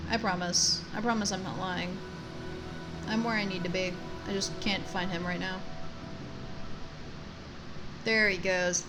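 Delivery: ordinary delivery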